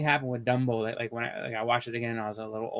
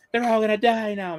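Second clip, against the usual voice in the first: imitating crying kid; 9 semitones higher, 5 dB louder than usual. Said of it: "I remember I was crying".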